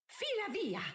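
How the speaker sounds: high, sharp dismissal; hissed order. A narrator's reading. Mother ordering her daughter away, hissing angrily. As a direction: send her away on a high, angry note. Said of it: "sibilò Araminta".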